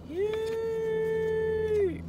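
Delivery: high squeaky yay; a tiny burst of joy; little cheer. Real, not acted. Small cheer after saying glad.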